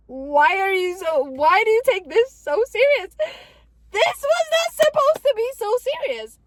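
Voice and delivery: loud, high whine of disbelief; hammed-up distressed complaint; exasperated protest. Exasperated protest at family's reaction.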